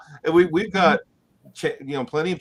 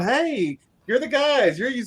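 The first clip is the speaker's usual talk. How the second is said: excited fan greeting; 10 semitones higher, slower than usual. Retold or imitated. Cruise fan spotting the couple, excited high call. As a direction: imitate a fan spotting them.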